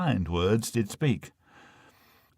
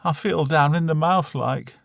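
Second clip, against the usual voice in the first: higher, homely mother voice; a glum northern mum; Lancashire mum voice; 5 semitones higher than usual. Acted. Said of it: "Ma says".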